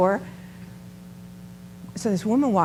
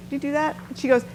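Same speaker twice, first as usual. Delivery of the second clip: usual speaking voice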